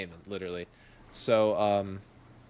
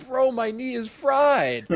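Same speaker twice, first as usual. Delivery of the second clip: much higher, louder, slow whine; whiny complaint; 11 semitones higher, 5 dB louder, slower than usual. Retold or imitated. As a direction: moan about your busted knee. Complaining about forced jump.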